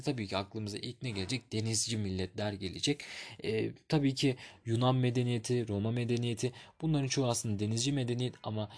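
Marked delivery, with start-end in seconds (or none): none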